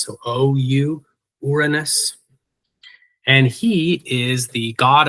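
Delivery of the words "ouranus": "Uranus" as OOR-uh-nus, with no y sound; O-U spelling pronunciation. Shown as a demonstration. Lecturer shows the O U spelling, "Uranus" pronounced OOR-uh-nus. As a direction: open "Uranus" on a pure oo, stressed first. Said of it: "you'll see an O at the beginning".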